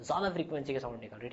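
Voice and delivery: quiet hurried restatement; quiet quick repeat. Shown as a demonstration. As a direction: toss the repeat off softly and quickly. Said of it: "तो ज़्यादा frequency की sound निकल रही है".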